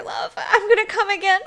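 breathless laugh through the words; laughing breathlessly. Acted. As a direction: laugh as you speak, out of breath. Laughs while nearing climax.

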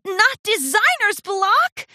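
high, loud, slow horrified question; drawn-out dismay; despairing disbelief. A narrator's reading. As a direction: gasp it as if it were a dreaded disease. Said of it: "shook her head in despair".

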